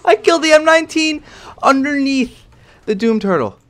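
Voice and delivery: high, loud triumphant scream; screaming with joy after an impossible shot; ecstatic yell. Real, not acted. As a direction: shout the win like you can't believe it. Celebrating a big kill.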